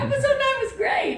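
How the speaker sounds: laughing reply. Real, not acted. Playful retort about tough episode.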